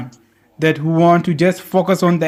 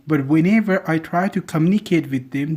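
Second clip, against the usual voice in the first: ordinary delivery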